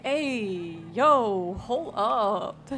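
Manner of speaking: excited. Retold or imitated. Acted reaction to muscles.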